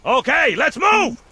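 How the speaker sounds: loud, high call to move; rally the squad; shouted order. Acted. Sergeant orders advance.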